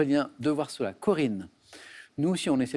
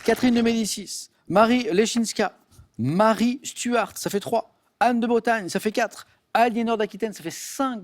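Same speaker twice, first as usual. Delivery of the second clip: higher and louder, each name called out; excited tally; 6 semitones higher, 4 dB louder than usual. Acted. Quiz host counting the contestant's answers aloud, excited and louder. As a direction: build the count with game show excitement.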